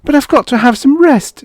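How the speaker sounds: high, loud desperate plea; wife pleading with her husband. Acted. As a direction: plead for a little rest. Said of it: "she pleaded".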